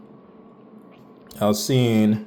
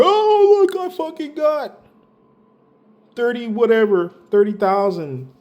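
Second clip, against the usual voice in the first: very high, squealed mock boast; exaggerated falsetto gloating, starting loud; mocking bragging idiots; 14 semitones higher, 3 dB louder than usual. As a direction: mimic clueless posters in a silly high voice. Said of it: "you idiots, essentially gonna be posting".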